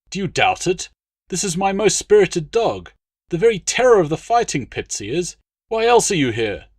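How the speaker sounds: dog dealer answering a doubting noble; barely restrained indignant dealer. A narrator's reading. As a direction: swallow the insult, still bristling.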